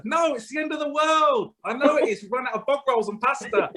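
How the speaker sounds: high, comic back-and-forth; mock film dialogue. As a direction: play both panicky characters.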